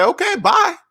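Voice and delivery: light, high, carefree okay bye; breezy goodbye. Pretend. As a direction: toss it off high and easy. Breezy brush-off after rejection.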